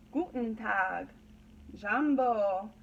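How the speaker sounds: normal talking voice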